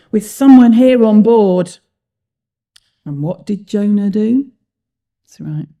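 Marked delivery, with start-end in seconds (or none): 0.1-1.8 very loud accusing cry. A narrator's reading. Captain suspects someone aboard, crying out. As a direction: boom it out as the captain. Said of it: "cried the captain".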